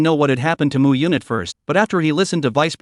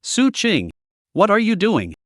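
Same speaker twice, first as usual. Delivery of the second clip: higher, flustered outburst; shy anxious blurt; 5 semitones higher, slower than usual. Acted. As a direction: blurt it out, embarrassed. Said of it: "blurted out shyly and anxiously".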